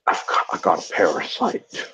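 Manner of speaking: derpy, goofy mock movie voice, low; Tom Hardy. Co-host mocking the Venom trailer, derpy goofy impression. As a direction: imitate the actor sounding stupid, low and barely voiced. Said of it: "real stupid and goofy".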